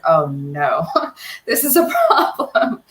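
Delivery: rising, half-laughing worry; a dad realizing the car's too fast; lightly voiced nervous father; alarmed dad. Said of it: "he was like".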